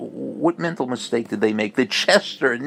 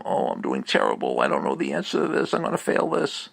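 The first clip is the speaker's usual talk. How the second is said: anxious inner voice; 11 semitones lower, 3 dB quieter than usual. Retold or imitated. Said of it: "I'm sure he was focusing on outcome".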